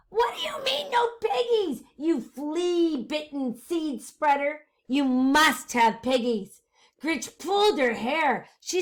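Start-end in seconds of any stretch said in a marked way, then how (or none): 0.1-6.6 angry witch voice. Acted. Witch rages at the farmer.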